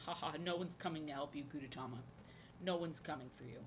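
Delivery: soft, gloating monster voice; a hint of a gloating villain; mock villain taunt. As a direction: taunt him quietly like a cartoon villain. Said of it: "I just sound like a monster".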